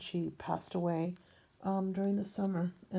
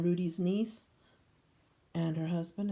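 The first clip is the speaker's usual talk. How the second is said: normal talking voice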